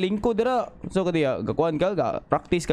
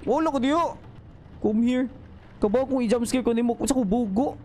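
nervous high voice; 7 semitones higher than usual. Real, not acted. Still scared in horror game.

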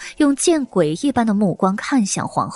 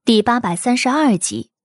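announcer; 3 semitones higher than usual. Acted. Credits read.

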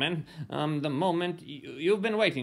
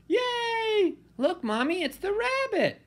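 put-on excited little kid. Child cheers at the rabbit.

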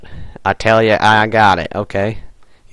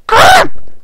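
very high loud cry; cartoon squeak of dismay; squeaky yelp; 6 dB louder than usual.

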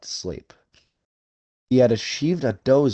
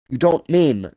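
crying out; 5 semitones higher, 6 dB louder than usual. A narrator's reading. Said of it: "cried Rainsford".

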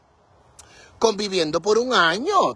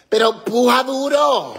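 louder, higher push; urging shout; 5 semitones higher, 4 dB louder than usual. Real, not acted. Urging her partner to pull harder, loud. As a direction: cheer him on hard.

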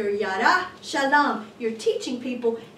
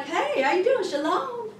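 high, lilting mock hello; breezy greeting; 7 semitones higher than usual. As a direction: greet a friend lightly, voice lifted. Mimics a casual sweet greeting.

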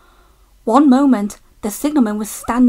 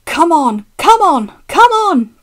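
loud urging, climbing in pitch; impatient, effortful shouts building up; cross puffing; 6 semitones higher, 8 dB louder than usual. A narrator's reading. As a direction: puff it crossly, higher each time. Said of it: "puffed James crossly".

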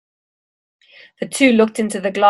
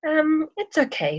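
a hesitant, nervous higher voice; 8 semitones higher, 5 dB quieter than usual. A narrator's reading. Boy nervously turning down the snake, higher and softer. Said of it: "said Jack nervously".

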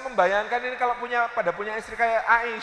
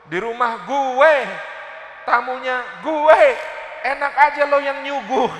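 jealous wife; 4 semitones higher, 4 dB louder, slower than usual. Retold or imitated. Jealous wife, offended in her house.

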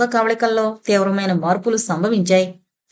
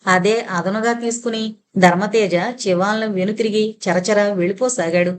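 plain reading voice

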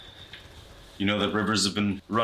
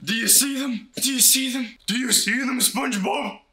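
much higher, shrieking hysterical terror; frantic panic. Acted. Doomsayer shrieking at SpongeBob about the demons, in panicked terror. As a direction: shriek like the sky is tearing open. Panicked apocalypse vision rant.

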